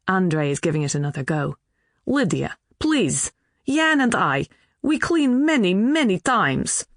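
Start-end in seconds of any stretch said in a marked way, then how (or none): 2.1-6.9 put-on pleading in a light Polish accent, higher; a hint of Polish accent, drawn-out many times; Polish accent, broken English. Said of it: "Speaking English".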